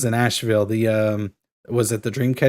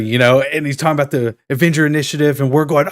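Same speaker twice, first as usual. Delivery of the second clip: ordinary talk, nothing marked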